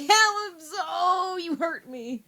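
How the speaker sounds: high, loud cry of fake hurt; mock wounded. Friend pained by mom's pronunciation, mock wounded. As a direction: wail as if the word pains you, then ease off. Mock pain at mispronounced word.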